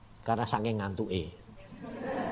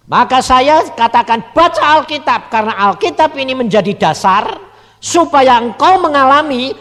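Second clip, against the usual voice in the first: preaching; 12 semitones higher, 14 dB louder than usual. Acted. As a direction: drive the warning home from the pulpit. Preaching at full force. (khotbah lantang dan lambat)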